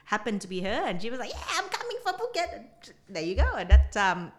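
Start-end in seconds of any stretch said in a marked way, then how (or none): 1.3-2.5 high, fast squeaky imitation of her friend; a fast, high put-on voice; mock excited friend. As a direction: squeak it out quickly like a giddy friend. Friend's excited quote, retold.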